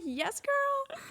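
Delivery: high, sassy cheer; excited. Pretend. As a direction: hype yourself up, sassy. Hyping herself during karaoke.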